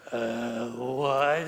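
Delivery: low, drawn-out puzzlement; stall, low and dumbfounded; baffled husband. Retold or imitated. Husband baffled by his wife's fine distinction, low and slow.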